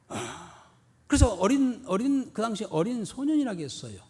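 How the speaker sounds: ordinary talk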